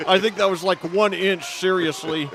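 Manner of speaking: can't believe how close; excited disbelief. Real, not acted. Reaction to the close play.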